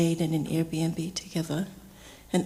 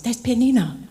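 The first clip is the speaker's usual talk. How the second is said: louder, higher retold reminder; emphatic prompting; 5 semitones higher, 6 dB louder than usual. Aunt reminding her husband who the niece is, emphatic. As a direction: imitate an aunt calling out who it is.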